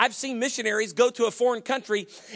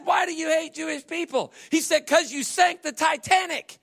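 higher, lightly acted exchange; voiced joke dialogue; 7 semitones higher than usual. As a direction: play both men with mild voices. Pilot and co-pilot exchange.

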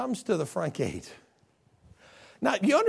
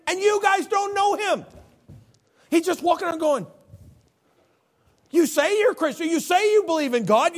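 high, loud preaching voice; fiery sermon delivery; full-force preaching; 12 semitones higher, 7 dB louder than usual. Acted. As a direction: preach it out over the congregation. Preacher retelling John eight.

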